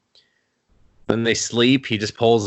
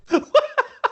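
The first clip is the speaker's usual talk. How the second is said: high laugh. Real, not acted. Laughing at own joke.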